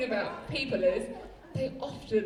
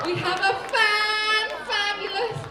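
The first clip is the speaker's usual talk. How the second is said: delighted; 8 semitones higher, 4 dB louder than usual. Real, not acted. Host exclaims over audience member's praise.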